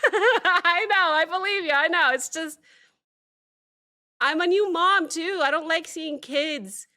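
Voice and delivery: high, loud, tearful protest opening on a laugh; really fighting tears, voice pushed up high; emotionally overwhelmed. Real, not acted. Choked-up new mom overcome by emotion.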